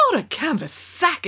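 a shocked little protest; exclaiming. Acted. Queen to prince, mildly shocked exclamation. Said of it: "exclaimed the queen".

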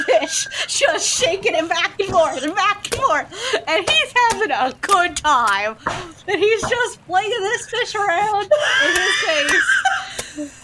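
high, animated storytelling; gleeful narration. A narrator's reading. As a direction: narrate the mayhem with mounting glee. Gleefully narrating the goose's fish-flinging.

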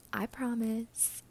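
ordinary talk

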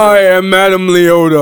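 slow, loud, drawn-out character line; Madame Leota. Retold or imitated. Co-host imitating the miscast actress, slow hammed-up Madame Leota. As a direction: stretch it out like a theatrical movie role. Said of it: "so she was like".

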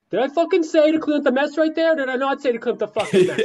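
Mark Wahlberg FBI agent. Retold or imitated. Imagined FBI agent yelling at Boston cops, high laughing mock rant. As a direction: bark at them like an angry Boston fed, cracking up. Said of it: "I just picture Mark Wahlberg as a, as an FBI agent".

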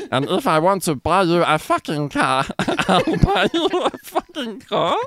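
high, slowing alien voice with laughs; goofy cartoon alien making a grand promise; Jar Jar Binks. Lover offering to buy a car, high Jar Jar impression. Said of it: "to read in Jar Jar Binks' voice".

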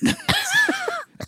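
real high giggle; squeaky burst of laughter. Real, not acted. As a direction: break into a falsetto laugh. Laughing after doctor quote.

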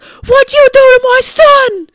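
big accusing shout, slowed down; angry father. Retold or imitated. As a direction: bellow it as the enraged dad. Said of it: "he's like".

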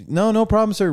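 a hint of a polite waiter brushing it off; high voice of waiter. Retelling the waiter waving off thanks, lightly high-pitched.

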